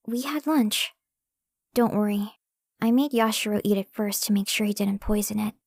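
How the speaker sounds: ordinary delivery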